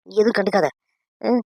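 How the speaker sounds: normal talking voice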